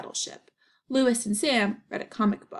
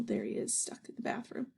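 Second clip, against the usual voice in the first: ordinary delivery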